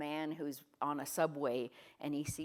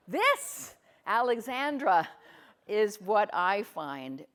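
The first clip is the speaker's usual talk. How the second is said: excited; 5 semitones higher, 10 dB louder, slower than usual. Real, not acted. Speaker excitedly singles out a participant.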